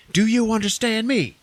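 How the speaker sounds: high, loud hero outburst; acted exasperated shout; forceful demand. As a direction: drive it home like a movie ultimatum. Hero's emphatic demand in acted quarrel.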